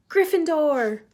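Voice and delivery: hat shouting. A narrator's reading. The Sorting Hat announcing Ron's house, shouting. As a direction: call the house out to the whole hall. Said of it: "the hat shouted".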